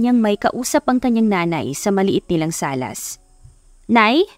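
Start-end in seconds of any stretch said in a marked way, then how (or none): 3.9-4.3 calls out high and a bit louder. A narrator's reading. Daughter calls to her mother, raised voice. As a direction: call to mother from the next room. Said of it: "malakas na sabi niya".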